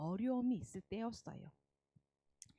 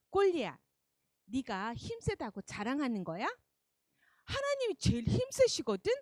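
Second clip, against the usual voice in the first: defiant; 4 semitones higher, 4 dB louder than usual. Acted. David challenges Goliath.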